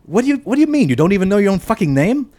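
high-pitched, baffled retort; incredulous. A narrator's reading. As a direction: blurt it in mock disbelief. Matt's baffled retort in the story.

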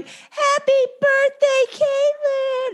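high, drawn-out birthday cheer; mock excited yell. Friend running out of school, mock excited yell. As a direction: squeal it without real volume.

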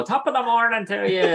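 high, hammed-up stage Irish accent, mocking the cliché. Irish actor mocking bad Irish accents in a cheesy stage Irish voice. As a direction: put on a cartoon Irish accent to mock bad imitations. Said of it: "sometimes they'll go, like, the diddly eye".

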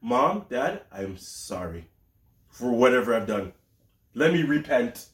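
low, slow mock confession; repentant child. Kids apologizing to Mom and Dad, low mock remorse. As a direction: play a contrite kid begging to repent. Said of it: "They'll be like".

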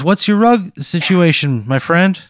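high, loud, nervous local ad voice; nervous TV pitchman. Acted. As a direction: play an awkward local TV salesman. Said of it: "He goes".

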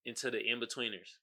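normal talking voice

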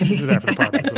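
fast, high, laughing agreement. Real, not acted. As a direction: say it through a laugh.

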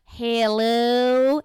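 loud, hammed-up Southern greeting; Southern drawl, playfully overdone; Southern accent. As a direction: put on a big Southern hello. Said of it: "my mom and I talk Southern".

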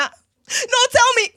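high, loud, slow, pleading shout; desperate demand. Acted. Frightened mother shouts at friend for the truth about her son. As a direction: cry out for the truth.

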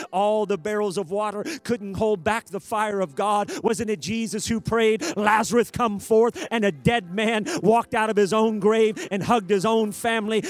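loud, pounding sermon cadence; full-force preaching. Real, not acted. Preacher recounting answered prayers at full force. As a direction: drive it home at full volume.

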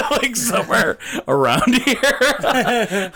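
high, laughing through the words. Real, not acted. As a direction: giggle out the punchline. Laughing at own joke.

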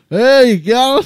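high, loud hammed-up Aussie greeting; mock Aussie accent. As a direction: play up the Australian twang on "How you going?". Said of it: "I'm so horrible at accents".

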